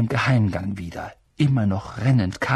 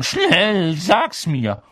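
high, loud, impatient order; Filch; 8 semitones higher, 4 dB louder, much slower than usual. A narrator's reading. Filch pressing Peeves to tell, impatient. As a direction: demand an answer at once. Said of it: "hörten sie Filch fragen".

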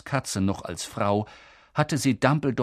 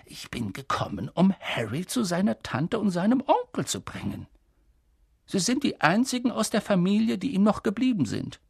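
lightly voiced Dumbledore, explaining; 9 semitones higher than usual. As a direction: explain it kindly, lightly in character. (sanft erklärender Schulleiter)

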